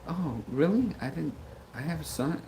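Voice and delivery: a hint of a bewildered old mom, trailing off; confused mom. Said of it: "She's like".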